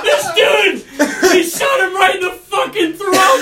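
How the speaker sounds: squealing through laughter, high and loud; real helpless, shouted laughter; cracking up. Real, not acted. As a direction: barely get it out for laughing. Cracking up telling his story.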